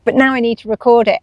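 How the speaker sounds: normal talking voice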